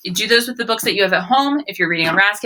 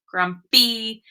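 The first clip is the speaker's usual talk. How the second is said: normal talking voice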